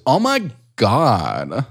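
put-on slow drawn-out Valley Girl; Valley Girl accent. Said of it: "in a very Valley Girl voice".

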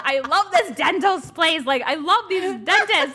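gushing. Retold or imitated. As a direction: rave about the dentists. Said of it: "I was like".

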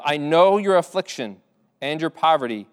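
earnest reading of Jesus' words; solemn Bible reading; scripture reading. Acted. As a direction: speak the promise to the church.

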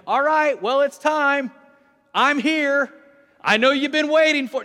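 loud, high casual arrival call; mock announcement. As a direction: announce yourself as if everyone expected you. Mocked version of Jesus arriving.

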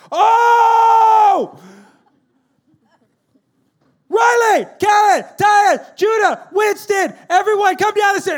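frantic parent hollering through the house; panicked wake-up shout. Acted. Panicked parent wakes late, calls kids.

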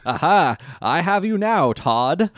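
loud gloating villain. Acted. Pegasus villain voice.

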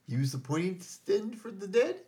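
mock teaching. Acting Jesus instructing Morgan.

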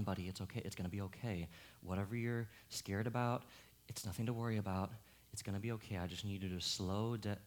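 put-on soft, low reassurance to a scared boy; calm little Isaac down gently; soothing child. Father gently calming his frightened little son, soft and low.

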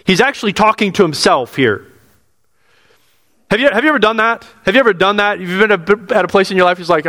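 usual speaking voice

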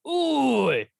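one sharp cockney call; hammed-up London oi. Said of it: "doing it British".

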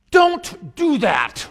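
emphatic plea. Retold or imitated. Priest imploring friends and siblings of Christ, loud slow plea. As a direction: beg them hard not to do it. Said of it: "it's in exclamation marks".

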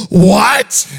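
be floored by the news; gasping shock. Retold or imitated. Daughter gasping at her father's confession, very loud. Child gasps at dad's confession.

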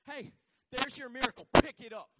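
loud shout of pick it up; shouted command. Retold or imitated. Preacher voicing Elisha shouting at his servant. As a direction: bark it out for the crowd. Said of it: "Here's what he did".

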